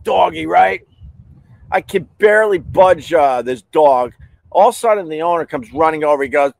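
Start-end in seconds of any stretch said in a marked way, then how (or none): none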